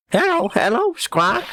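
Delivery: high, squawky parrot voice; talking parrot. Acted. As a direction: screech it like a pet bird. Parrot repeats its stock greeting.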